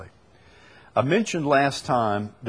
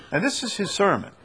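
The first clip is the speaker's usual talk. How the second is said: ordinary delivery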